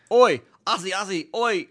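hammed-up Aussie shout-out; Australian accent. Host mocking Australians, hammed-up Australian accent.

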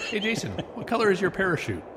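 normal talking voice

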